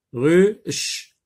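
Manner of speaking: "ruche" as ru, pause, a hushed ch; split into syllables. Shown as a demonstration. Teacher isolating the ch sound, "ruche" split in two. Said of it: "Dans la deuxième syllabe".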